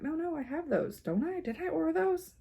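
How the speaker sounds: high, doubting self-quote; retold self-questioning. Retelling watching a floss tube, high puzzled self-questioning. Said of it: "and was like".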